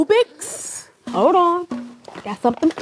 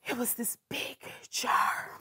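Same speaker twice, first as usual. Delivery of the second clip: whisper; 5 semitones lower, 13 dB quieter than usual. Acted. Whispering about slime.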